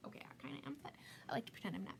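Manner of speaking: playful stage whisper; conspiratorial whisper to the audience; whispered aside. Acted. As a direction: whisper it like a secret joke. Joking aside to viewers.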